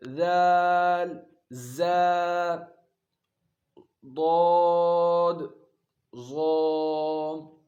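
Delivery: loud, very slowly drawn ذال and ضاد; letter articulation. Shown as a demonstration. Teacher demonstrating four z letters, drawn-out calls. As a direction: hold each letter long and clear. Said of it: "جیسے".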